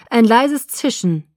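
plain reading voice

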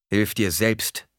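firm, curt order; commanding. A narrator's reading. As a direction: order it without sympathy. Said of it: "befahl Leto".

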